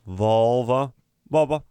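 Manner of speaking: first Volvo with v, second Volvo as Bobo; V swapped to B. Retold or imitated.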